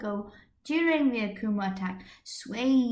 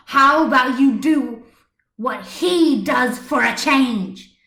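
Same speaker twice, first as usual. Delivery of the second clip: loud, higher furious scolding; furious tirade; 5 semitones higher, 8 dB louder than usual. Acted. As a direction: tear into the man with blazing fury. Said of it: "her eyes blazing with fury".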